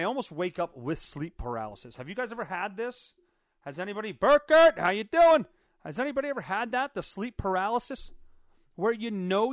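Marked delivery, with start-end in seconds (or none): 4.1-5.5 high, shouted hello; loud greeting. Real, not acted. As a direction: call out to a viewer who just turned up. Calls out greeting to arriving viewer.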